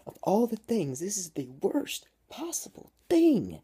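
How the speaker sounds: high, loud anguished outcry; shouting. A narrator's reading. Girl wailing over her dying captain, shouting. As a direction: drag out the last words in a full dramatic yell. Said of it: "she shouts".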